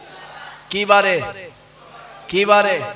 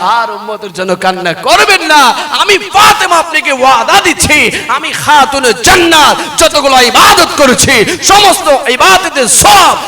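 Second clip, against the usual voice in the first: loud, high declamation; ringing, forceful pledge; impassioned vow; 6 semitones higher, 12 dB louder than usual. Retold or imitated. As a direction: proclaim a vow at full preaching force. Acts Fatema's impassioned vow.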